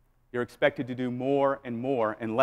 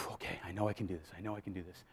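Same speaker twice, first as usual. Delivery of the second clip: hushed self-talk; 6 semitones lower, 17 dB quieter, faster than usual. Retold or imitated. Nervous inner self-talk.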